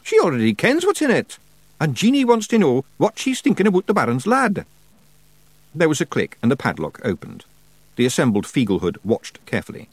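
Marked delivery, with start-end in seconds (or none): 0.0-4.6 high, loud broad Scottish wee man; a cocky little pixie laying down the law; Rob Anybody Feegle. A narrator's reading. Rob continues.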